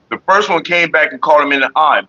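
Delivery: ordinary talk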